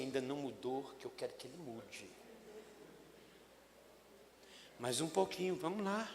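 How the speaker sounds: very soft, low inner voice; Jesus to himself. Acted. Jesus thinking quietly about Peter, soft and patient. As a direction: murmur it patiently. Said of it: "Jesus lá dentro falou assim".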